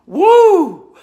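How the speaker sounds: high, loud cheer of delight; excited whoop. Real, not acted. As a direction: whoop it out like a win. First espresso shot comes out.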